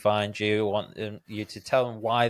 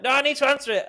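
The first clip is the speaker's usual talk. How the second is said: much higher-pitched mock protest; insistent inner voice; 4 dB louder, much faster than usual. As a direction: blurt it out as a stubborn inner objection. Voicing the mind pushing back.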